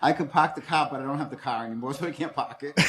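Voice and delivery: playful Boston "pahk the cah"; Boston accent. Pretend. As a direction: joke in broad Boston, dropping every R. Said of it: "There's that Boston accent".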